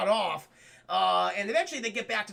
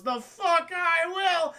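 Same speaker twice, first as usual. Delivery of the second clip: defiant retort; 7 semitones higher, 4 dB louder, much slower than usual. Acted. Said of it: "She goes".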